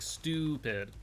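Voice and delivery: STUPID with a long, drawn-out "oo"; STUPID said as "stooo-pid"; extended U in STUPID. Shown as a demonstration. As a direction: stretch the first syllable of STUPID slowly. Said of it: "you like to extend the, the U on S Ts".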